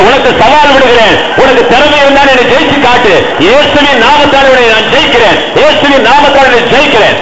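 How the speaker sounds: high, loud battle-cry preaching; defiant declaration. Shown as a demonstration. Preacher defying problems and Satan in Jesus' name, high shouted declaration. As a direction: build to a full-force shout of challenge. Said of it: "இப்படி பேசினா நல்லா இருக்கும்".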